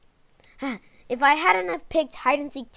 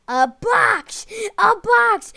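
high, loud excited yell; excited shout; 9 semitones higher, 8 dB louder, slower than usual. Real, not acted. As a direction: shout it out in triumph. Excited at the revelation.

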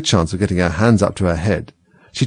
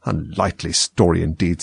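lower, scornful remark; contemptuous; 4 semitones lower, 3 dB quieter than usual. A narrator's reading. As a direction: drip with contempt. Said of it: "in a tone of the deepest contempt".

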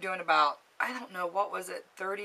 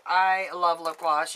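ordinary talk, nothing marked

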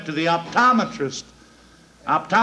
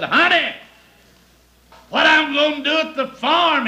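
pleading wife; 7 semitones higher, 4 dB louder than usual. Acted. Wife calling from shore.

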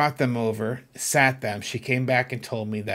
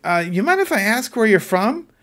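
higher-pitched, tentative polite question; retold surprised question, pitched up; taken aback; 8 semitones higher, 4 dB louder than usual. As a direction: ask it as if caught off guard. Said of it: "it kinda took me aback".

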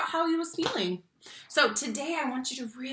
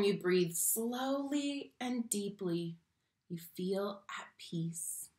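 calm; 2 semitones lower, 7 dB quieter, slower than usual. Acted. Teacher soothing the color monster into calm, soft and slow.

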